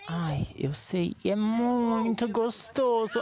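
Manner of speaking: very slow, drawn-out seductive voice; sensual. Shown as a demonstration. Showing a friend the sexy way, sensual. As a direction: draw out every word as a sultry tease. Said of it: "do jeito sensual".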